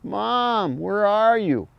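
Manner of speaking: high, drawn-out crying fawn voice; fawn distress cry. Shown as a demonstration. Hunter demonstrating a fawn's distress bleat. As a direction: call for mom like a scared fawn. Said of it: "So I open that hand up and go".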